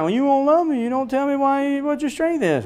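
high, pleading mock sob; whiny crying Delilah. Delilah pleads tearfully with Samson, high mock whine. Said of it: "she starts crying".

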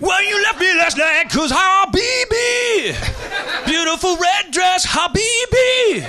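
high, loud, drawn-out wail; mock foreign singer. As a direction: belt it with long held notes on Habibi. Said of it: "what country this guy came from".

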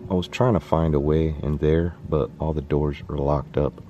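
usual speaking voice

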